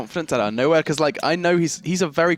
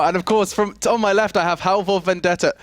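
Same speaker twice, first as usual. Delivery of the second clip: a light attempt at a Norwegian accent, a bit louder; 6 semitones higher, 4 dB louder than usual. Host introducing his co-caster, mock Norwegian accent on the name. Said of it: "haven't quite got my Norwegian accent down".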